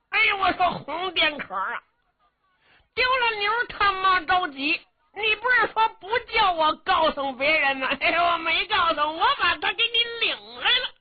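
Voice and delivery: put-on high, fast, childlike voice of a dim giant; simple-minded young giant. Simple young hero to the squire, high childish blurting. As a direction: blurt it out like a big naive boy.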